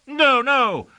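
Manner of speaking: high-pitched servant's protest; high, anxious denial. A narrator's reading. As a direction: plead against the charge, pitch way up. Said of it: "protested Jambart".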